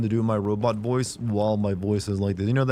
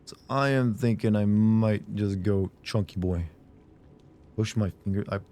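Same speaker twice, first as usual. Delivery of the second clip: normal talking voice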